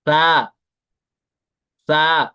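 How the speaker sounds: सा said flat with no hiss; loud clear सा without a whistle. Shown as a demonstration. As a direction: say सा with no whistle, tongue tip at the upper teeth. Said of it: "ऐसे".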